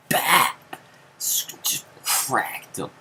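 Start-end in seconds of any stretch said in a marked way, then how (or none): 0.1-0.8 loud, high "Bap!" smack; punch sound effect. Retold or imitated. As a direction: mimic the punch landing hard. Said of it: "I was like".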